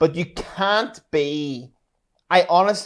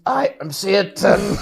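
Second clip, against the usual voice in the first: mock satanic declaration; slower than usual. Joking [name] persona.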